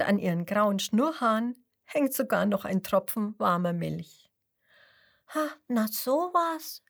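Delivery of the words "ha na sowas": a light surprised Ha, pitched up; mildly amazed little exclamation; surprised thought. Acted. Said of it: "denkt Lisa".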